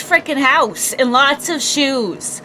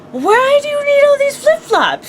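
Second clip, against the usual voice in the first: high, baffled why-question; incredulous question; 12 semitones higher than usual. Retold or imitated. Asking her mom why she needs so many flip-flops, baffled and high. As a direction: act out disbelief at the pile of flip-flops. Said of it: "I'm like".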